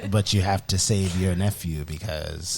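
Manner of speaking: husky smoky coaxing; low, slow, ominous prophetic voice; Madam Web voice. Retold or imitated. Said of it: "Your Madam Web voice is wild".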